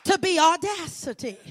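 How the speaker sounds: fervent preacher's cadence, rising and falling; preaching. Acted. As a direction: preach it to the congregation with conviction.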